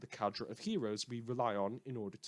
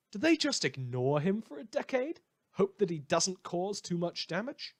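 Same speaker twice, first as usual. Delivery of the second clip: rhetorical outburst; 8 semitones higher, 6 dB louder than usual. Acted. Host's mocking rhetorical questions.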